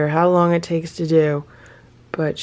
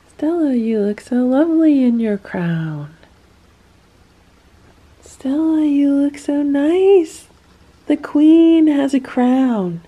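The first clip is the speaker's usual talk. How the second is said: much higher, louder pet-talk praise; high cooing pet voice; 7 semitones higher, 4 dB louder than usual. Real, not acted. Praising cat in crown.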